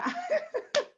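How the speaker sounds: a squeaky high laugh, nearly crying; high emotional laugh. Real, not acted. Host moved after a testimonial, high squeaky laugh.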